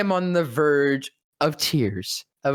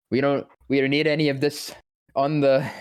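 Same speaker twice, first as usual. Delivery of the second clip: ordinary talk, nothing marked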